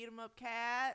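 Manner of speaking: loud rally chant; school cheer. Real, not acted. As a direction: cheer it like a fan at a game.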